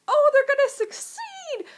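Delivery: high, loud hopeful cheer; excited cheer. Retold or imitated. Reader sure the heroes will win, high cheer. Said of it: "every time I was like".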